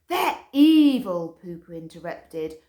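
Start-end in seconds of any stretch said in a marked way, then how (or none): 0.1-1.4 slow loud they're evil; dark insistence. A narrator's reading. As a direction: lean hard on evil. Poo Poo interrupts.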